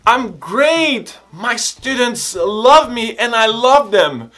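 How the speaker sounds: high, loud and drawn-out delight; happy. Acted. Teacher shows students how to say why you're happy, elated. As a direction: gush it out, stretching the words. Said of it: "o porquê de você estar feliz".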